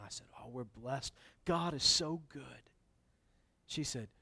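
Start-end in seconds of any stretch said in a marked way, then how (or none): 0.4-2.7 slow, pious church phrasing; churchy talk. Retold or imitated. Him answering the lady in stock church phrases. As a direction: lay on the church-speak gently. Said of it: "you talk like them".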